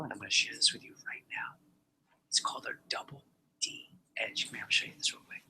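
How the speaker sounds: whispered like a sales secret; secretive whisper. Shown as a demonstration. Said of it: "It's a secret".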